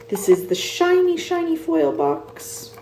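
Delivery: a high, sing-song show-off of the shiny box; playful showing off. Real, not acted. Showing the viewers the shiny foil box, high and playful. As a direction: coo over the shiny foil like a treasure.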